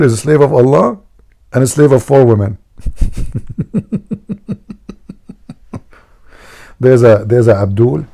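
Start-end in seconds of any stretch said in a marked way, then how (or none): 2.8-5.5 long, high, breathy giggle; amused laughter. Real, not acted. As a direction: crack up at your own joke. Laughing at own joke.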